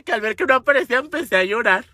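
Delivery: usual speaking voice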